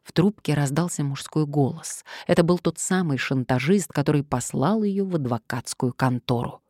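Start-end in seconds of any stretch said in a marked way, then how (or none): none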